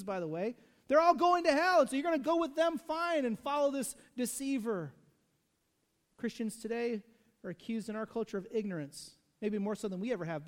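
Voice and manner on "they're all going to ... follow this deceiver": high, loud ranting contempt; mock scorn. Preacher acting out Pharisees damning the crowd, loud and high. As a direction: rant like a sneering Pharisee.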